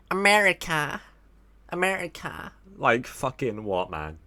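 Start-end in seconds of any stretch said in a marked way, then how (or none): 0.1-2.5 high put-on take on someone's American accent; mock the American way of saying "America", pitched up; imitated American pronunciation. Streamer teasing a viewer about saying America, mock accent. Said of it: "How do you say it, Brendan? You go".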